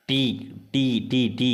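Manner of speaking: டி repeated short and crisp on its own, over and over; repeating the syllable. Shown as a demonstration. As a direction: drill the syllable டி again and again like a teacher. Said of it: "ஈ உச்சரிப்பு வருதா?".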